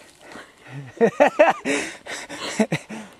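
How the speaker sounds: loud high laughter fit; burst of laughter. Real, not acted. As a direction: break out laughing loudly. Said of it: "Sorry that I'm laughing".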